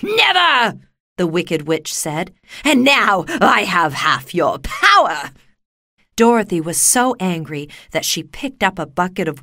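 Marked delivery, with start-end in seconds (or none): 0.0-0.8 high, loud witch cry; defiant wicked witch. A narrator's reading. Witch refuses Dorothy. 2.6-5.3 loud, slow witch boast; gloating wicked witch. A narrator's reading. Witch gloats over Dorothy.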